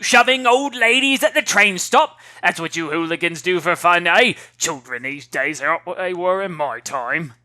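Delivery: put-on an old lady grumbling about today's youth; cranky old woman.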